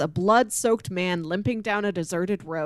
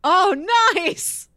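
excited; 11 semitones higher, 5 dB louder than usual. Real, not acted. Reacting to the pick.

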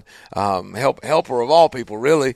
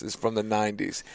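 normal talking voice

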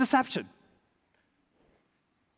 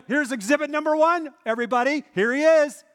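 mock accusation; 7 semitones higher, 4 dB louder than usual. Bystanders accusing Peter.

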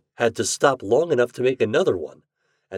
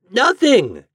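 high, loud mock gasp; 10 dB louder than usual. A narrator's reading. Toad struggling to free himself, gasped refusal. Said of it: "gasped Toad".

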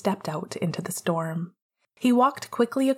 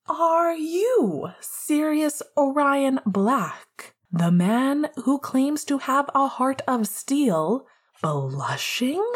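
playful teasing; 6 semitones higher, slower than usual. Acted. James teases blushing Sirius.

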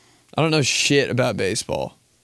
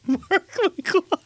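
much higher, breathless with laughter; giddy exclamation. Real, not acted. Comedian giddily repeating his favorite player's name. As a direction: say it like a giggling fan. Reverent naming after laugh.

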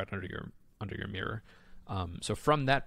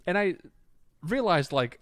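usual speaking voice

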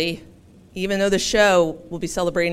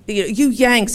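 a light mock English accent; 5 semitones higher, 6 dB louder than usual. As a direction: tease the Yanks in an English accent. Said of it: "forgive my English accent".

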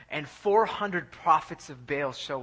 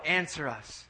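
ordinary delivery

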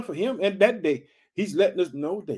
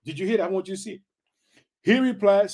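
ordinary talk, nothing marked

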